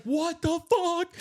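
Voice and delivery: slow, very high, loud disbelief; drawn-out shriek. Retold or imitated. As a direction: stretch it out in horror. Ray sees possum.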